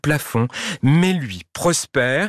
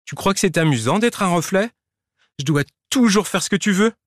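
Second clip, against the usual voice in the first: higher, aggrieved complaint; resentful reflection; 8 semitones higher than usual. Acted. The reflection to Prosper, bitterly complaining. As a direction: pour out years of grievance.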